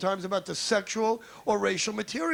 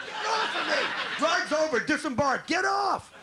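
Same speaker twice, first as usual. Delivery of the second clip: mock shout; 7 semitones higher, slower than usual. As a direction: bark it like a ride operator clearing the car. Shaking wife off after sex.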